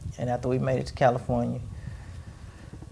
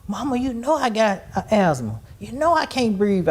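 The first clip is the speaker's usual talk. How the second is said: high, whiny mock of a complaining elder; imitating old-person complaint; 8 semitones higher than usual. Mother claiming asthma to her daughter, high whiny imitation. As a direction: play her fussing over a made-up asthma. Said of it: "She's like an old person".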